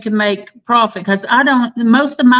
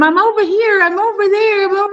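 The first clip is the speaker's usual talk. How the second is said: high, small playful bird voice; a tiny high voice playing hide and seek; little voice; 8 semitones higher than usual. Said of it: "the little voice coming from".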